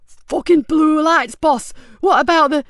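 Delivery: high, loud alarm; startled henchman yelling; panicked thug. Acted. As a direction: blurt out in panic. Panicked thug alerts boss.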